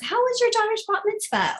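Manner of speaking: high-pitched, keenly interested question; interested caller. Acted. Caller remembering a detail about the donor's family, keenly interested. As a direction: ask it way up high, full of interest. Acts out a caller's caring follow-up.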